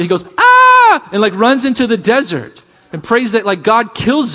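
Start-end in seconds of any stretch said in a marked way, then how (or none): 0.4-1.1 very high, loud comic shriek; mock terrified scream. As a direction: scream in hammed-up panic. Acting out Elijah's panicked flight.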